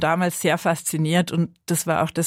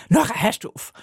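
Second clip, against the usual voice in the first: parents' scolding; 4 semitones higher than usual. Retold or imitated. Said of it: "sie haben dann natürlich immer gesagt".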